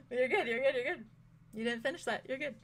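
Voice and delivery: reassuring through real laughter; giggling you're good between laughs; laughing. Real, not acted. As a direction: laugh while you reassure.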